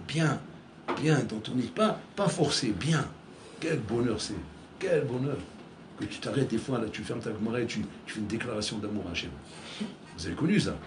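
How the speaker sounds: ordinary talk, nothing marked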